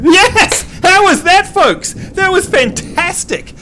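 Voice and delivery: high, excited burst of praise; delighted cheering. Real, not acted. Host praises the learner's attempt, excited cheer.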